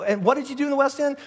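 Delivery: curious questioner. Acted. People asking a churchgoer about Christmas, high-pitched questioner. Acted questioner, second round.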